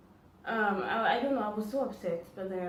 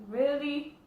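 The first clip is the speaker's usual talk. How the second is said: high, louder disbelieving "Really?"; incredulous; 6 semitones higher, 5 dB louder than usual. Retold or imitated. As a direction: react in stunned disbelief. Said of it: "I'm like".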